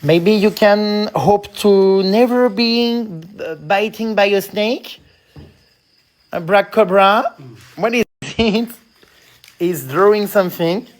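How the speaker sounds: slow, loud English with a French accent. Real, not acted. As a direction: spell it out slowly for a foreigner, French accent. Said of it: "mon accent vous fait très rire".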